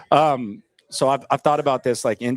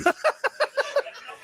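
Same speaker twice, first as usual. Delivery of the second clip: high laugh; 5 dB quieter than usual. Real, not acted. Laughing at own joke.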